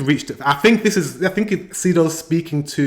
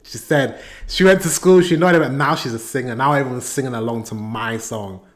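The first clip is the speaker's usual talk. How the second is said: normal talking voice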